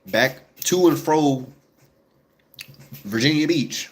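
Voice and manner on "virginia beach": ordinary talk, nothing marked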